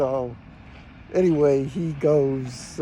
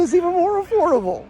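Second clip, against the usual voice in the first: much higher, louder, gloating comeback; gleeful retort; 13 semitones higher, 4 dB louder than usual. Retold or imitated. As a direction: toss it back with delighted triumph. Retelling his own cheeky comeback.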